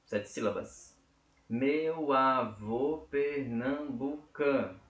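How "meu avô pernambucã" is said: very slow, drawn-out syllables, halting at "cã"; syllable-by-syllable counting. Shown as a demonstration. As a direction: count out each syllable to the stressed one. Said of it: "Por que eu contei até o cã".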